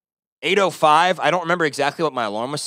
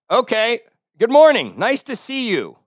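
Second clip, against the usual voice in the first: sarcastic greeting; 7 semitones higher, 5 dB louder than usual. Retold or imitated. Sarcastic reply.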